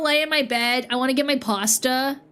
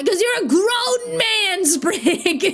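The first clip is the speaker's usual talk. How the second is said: mock scolding; 9 semitones higher than usual. Mock scolding grown man.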